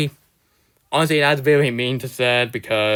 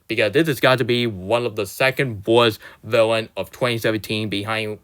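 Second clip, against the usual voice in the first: ordinary delivery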